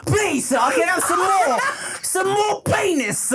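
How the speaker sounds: high, loud mock orphan plea; begging British urchin. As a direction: beg like a hammed-up British waif.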